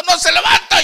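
very high mock shout of despair; mock tearful wail. New convert repeating his complaint to the pastor, mock tearful wail. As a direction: imitate him wailing it out loudly. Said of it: "y se puso a llorar".

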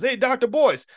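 high-pitched call across the street; mock yell to someone over the road; imitated street yell. Stranger hailing a familiar face across the street, imitated yell. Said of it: "a guy yells across the street".

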